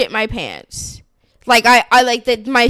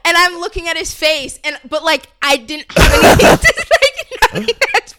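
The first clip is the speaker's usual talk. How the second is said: high, loud, breaking into stunned laughter; half-laughing at the absurd comment; laughing in disbelief; 10 semitones higher, 6 dB louder than usual. Real, not acted. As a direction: laugh through it, still can't believe it.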